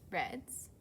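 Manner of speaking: ordinary talk, nothing marked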